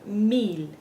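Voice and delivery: MIL with pure Spanish ee, "meel". Shown as a demonstration. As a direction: model MIL as meel for learners. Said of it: "be faithful to that sound".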